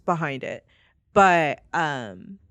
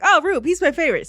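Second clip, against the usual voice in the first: quick, high, louder gush; retold eagerness; 8 semitones higher, 6 dB louder, faster than usual. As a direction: gush like a fan, acting out your earlier delight. Retells own eager reaction before watching.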